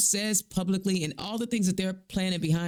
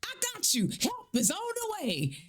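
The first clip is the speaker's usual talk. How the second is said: mock encouragement; 12 semitones higher, 3 dB quieter than usual. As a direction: imitate a hollow cheerleader up in a high pitch. Mocking promise made to Iranians.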